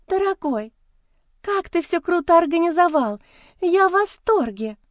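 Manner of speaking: put-on high, squeaky, gushing girl voice; breathy cutesy giggly. A narrator's reading. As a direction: gush like a giddy mistress. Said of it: "Продолжала она восхищаться".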